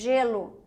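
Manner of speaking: "Gelo" with a very long buzzing zh before "elo"; soft G. Shown as a demonstration. Teacher contrasting it with gato, long soft G. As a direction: hold the first consonant of "Gelo" as a voiced hush. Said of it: "são sons diferentes".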